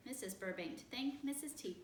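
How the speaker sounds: plain reading voice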